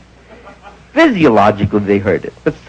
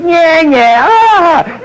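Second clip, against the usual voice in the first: high, loud jeering yeah yeah; mocking taunt; 12 semitones higher, 4 dB louder, much slower than usual. Man in cab taunts Barney, high jeering. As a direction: jeer at him, voice way up.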